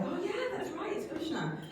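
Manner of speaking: high, soft voice of sudden realization; softly squeaked retold "aha" moment; mock realization. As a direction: imitate someone as it dawns on them. Visitors' sudden realization, voiced in retelling.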